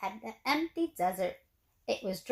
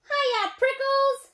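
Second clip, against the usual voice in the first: much higher, louder teasing shout; 13 semitones higher, 5 dB louder, slower than usual. A narrator's reading. Jackrabbit dashing by, shouting a cheeky greeting. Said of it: "she shouted".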